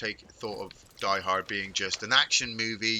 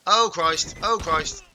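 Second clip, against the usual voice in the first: higher, louder real exclamation of alarm; startled; 4 semitones higher, 6 dB louder, slower than usual. Real, not acted. Streamer startled by an unseen rock in the game, alarmed. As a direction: blurt it out twice, caught off guard.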